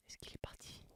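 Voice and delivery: fearful hushed whisper. Acted. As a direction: whisper from a hiding place. Hiding under desk.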